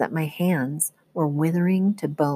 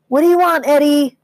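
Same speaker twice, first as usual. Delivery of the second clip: much higher, louder angry yell; 10 semitones higher, 8 dB louder than usual. A narrator's reading. Trucker yells at Eddie outside, loud and high. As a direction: bark it through the trailer wall. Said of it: "The trucker inside with us yelled".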